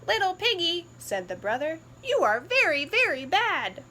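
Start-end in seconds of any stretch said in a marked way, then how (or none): 0.1-0.8 higher, louder taunting brother; teasing brother pig. Acted. 2.0-3.8 higher, louder taunting brother; teasing brother pig. Acted.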